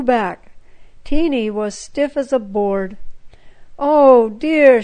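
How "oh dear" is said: a loud, higher anguished cry; a little girl crying out in grief. Acted. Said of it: "she cried".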